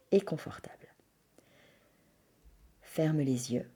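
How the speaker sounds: soft, hushed yoga nidra voice with long pauses; guided meditation. Acted. Leads a yoga nidra relaxation.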